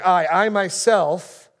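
slow, loud I, I myself with a high falling I; preacher echoing God's emphatic claim. Acted. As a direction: lean hard on "I, I myself", drawn out. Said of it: "God's emphatic".

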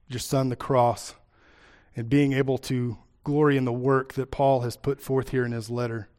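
soft, reverent prayer voice. Acted. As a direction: pray quietly and humbly. Pastor leads a prayer.

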